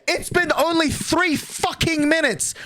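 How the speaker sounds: slow, high-pitched exasperated yell. Real, not acted. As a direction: yell it out, stretching the words. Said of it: "I'm yelling at twelve fifty-three".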